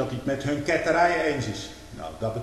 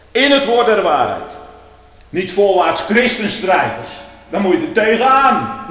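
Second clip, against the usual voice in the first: louder and higher, hammering each word from the pulpit; fiery preaching; 6 semitones higher, 10 dB louder than usual. Acted. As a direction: preach it at full force. Full-force preaching.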